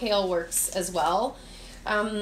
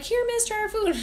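lightly voiced students, pitched much higher; imitating students; 13 semitones higher than usual. Teacher mimicking her students offering untasted food, higher. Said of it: "my students are like".

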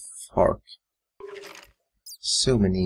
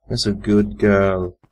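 pet praise; slower than usual. Acted.

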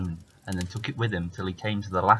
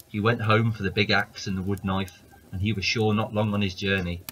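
usual speaking voice

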